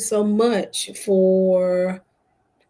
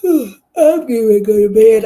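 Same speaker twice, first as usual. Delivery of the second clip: tired; 4 semitones higher, 11 dB louder than usual. Real, not acted. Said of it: "As you can hear it in my voice".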